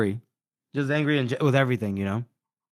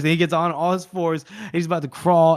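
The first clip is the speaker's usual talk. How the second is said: ordinary talk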